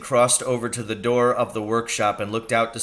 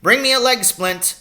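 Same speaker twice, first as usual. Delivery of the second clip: called order; 8 dB louder than usual. Acted. Doctor calls to ambulance men.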